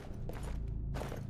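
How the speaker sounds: harsh throat clear. Real, not acted. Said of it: "the Topo Chico went down the wrong pipe".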